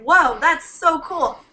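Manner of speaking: retold awe. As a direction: relive being impressed, slow and high. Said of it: "I'm like".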